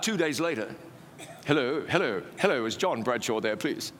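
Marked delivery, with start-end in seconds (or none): 1.5-3.9 put-on lower-pitched, delightful English accent. Pastor phoning to ask for John, English accent. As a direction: play a courteous English pastor on the phone. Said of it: "with a delightful English accent".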